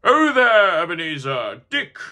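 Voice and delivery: loud, hearty call of a jolly fat employer; rich, comfortable, booming jolly call; jovial old master. Acted. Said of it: "comfortable, oily, rich, fat, jovial tone".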